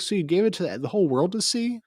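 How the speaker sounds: high, loud incredulous question; mock incredulous friends. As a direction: ask it high in mock disbelief. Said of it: "they're like".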